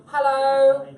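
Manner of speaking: a hammed-up British accent, like a UK weatherman; mock British accent. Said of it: "I'm sorry for the accent".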